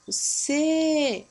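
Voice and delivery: "você" with the final "cê" drawn out; stretched ending on "você"; stressed last syllable. Shown as a demonstration. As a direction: hold "cê" long to show the stress. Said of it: "é a palavra que se estende".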